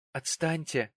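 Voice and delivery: a father's pained exclamation. A narrator's reading. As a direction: repeat it with hurt in the voice. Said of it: "с болью в голосе".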